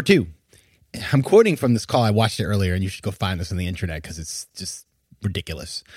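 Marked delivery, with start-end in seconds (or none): none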